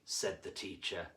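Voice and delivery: plain reading voice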